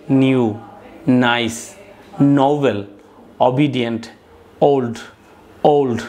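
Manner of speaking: each English adjective said alone, slow and clear, with a falling end; careful word pronunciation. Shown as a demonstration. Teacher reading vocabulary list aloud to students, careful model pronunciation. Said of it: "শুদ্ধভাবে উচ্চারণ করছি".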